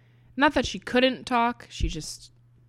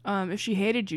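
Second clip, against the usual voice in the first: ordinary talk, nothing marked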